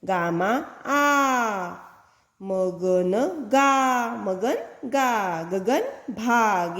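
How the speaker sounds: short sentences read very slowly, word by word. Shown as a demonstration. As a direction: read each little sentence slowly aloud to children. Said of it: "इन शब्दों से बने हैं ये नीचे वाले वाक्य". (वाक्य धीरे-धीरे पढ़कर सुनाना)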